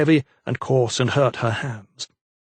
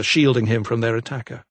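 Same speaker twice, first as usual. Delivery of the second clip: plain reading voice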